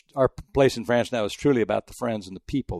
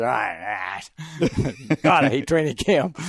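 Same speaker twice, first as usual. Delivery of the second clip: put-on John McClain's accent; 3 semitones higher, slower than usual. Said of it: "I can't do the McLean accent".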